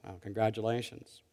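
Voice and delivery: very soft, dry mock praise; sarcastic congratulations. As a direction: deliver the praise quietly as a reproach. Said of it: "with that tone".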